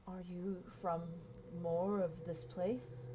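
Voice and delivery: soft, slow hushed question; hushed giant. Acted. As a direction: lean in and ask quietly. Giantess whispers curiously.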